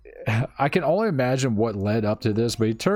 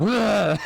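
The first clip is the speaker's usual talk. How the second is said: mock gagging noise, pitched up; imitated retching; 9 semitones higher than usual. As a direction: make a quick throw-up sound. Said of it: "fucking finger down her throat".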